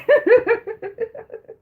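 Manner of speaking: high giggling burst; high laugh. Real, not acted. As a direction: giggle helplessly. Laughing at own impression.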